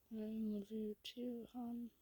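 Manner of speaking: very soft, drowsy murmur; barely awake, softly returning affection; half-asleep. Acted. Goob waking just enough to answer his partner, sleepy. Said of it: "wakes up just enough to say".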